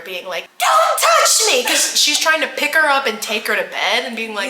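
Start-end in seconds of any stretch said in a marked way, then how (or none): 0.6-1.6 high, loud scream; yelling. Retold or imitated. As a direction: screech it at her mother. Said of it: "yelling at each other".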